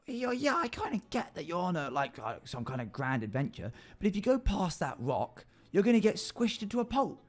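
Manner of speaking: fast, high goblin pleading. Acted. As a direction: squeak it out like a fed-up goblin. Goblin argues about path.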